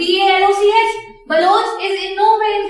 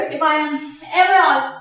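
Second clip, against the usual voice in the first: ordinary delivery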